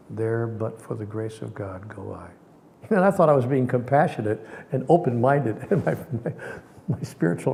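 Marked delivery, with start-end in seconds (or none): 0.1-2.3 low, slow, fading to near silence; solemn pity. Retold or imitated. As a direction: repeat it piously, softer. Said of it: "I said to my friend".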